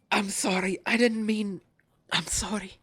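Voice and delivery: high, pained pleading fading to a whisper; tearful apology. Acted. Wounded man whispering his apology to Rune. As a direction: apologize through the pain.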